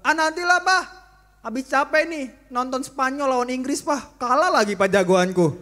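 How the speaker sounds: loud, high whining excuse from a sleepy son; whiny sleepy son. Acted. Son whining loudly to his father about being tired.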